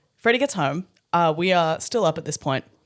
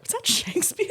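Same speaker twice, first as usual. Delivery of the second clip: high soft incredulous question; mock amazed; 4 dB quieter than usual. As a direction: ask it in mock wonder.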